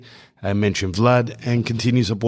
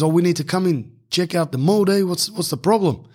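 put-on higher, lightly done Islander accent; 7 semitones higher than usual. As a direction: greet him with a light Islander lilt. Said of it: "He goes".

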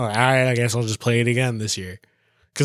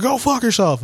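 high, louder mock yell at rich ladies; mock shout; 11 semitones higher, 4 dB louder than usual. To a friend, imagining cursing at rich ladies: mock yell. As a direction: yell it crudely to shock them. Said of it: "you go to the rich part and you're like".

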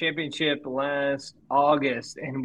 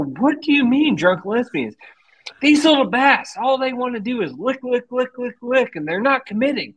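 high, hammed-up imitation of a ranting old angler; cranky old angler explaining bass, pitched up and mocking; mock old fisherman; 10 semitones higher than usual. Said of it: "I go".

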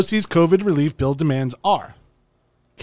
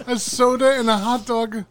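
mock broken English; 6 semitones higher than usual. Cohost repeats the punchline.

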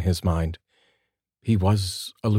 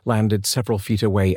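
usual speaking voice

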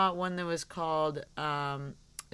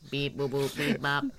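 toy robot voice; 2 semitones lower than usual. Pretend. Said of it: "kinda cool to have Porygon around".

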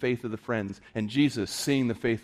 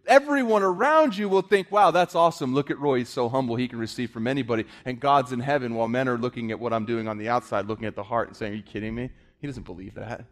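1 preaching at full force; 10 semitones higher, 6 dB louder than usual. Acted.

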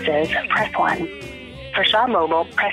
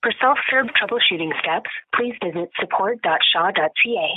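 phone menu voice. Real, not acted. Shaw phone menu played.

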